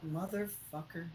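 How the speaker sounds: usual speaking voice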